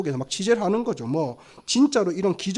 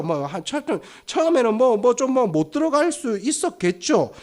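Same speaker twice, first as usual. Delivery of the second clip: ordinary talk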